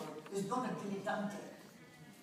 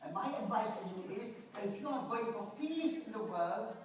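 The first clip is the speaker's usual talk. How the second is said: ordinary delivery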